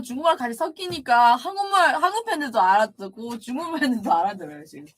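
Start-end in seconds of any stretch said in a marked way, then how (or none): none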